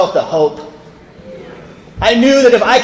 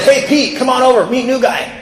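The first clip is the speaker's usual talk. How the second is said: high-pitched imitated hail; mock holler, pitched well up; calling out; 5 semitones higher than usual. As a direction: call a buddy over to you. Jack calls Pete over.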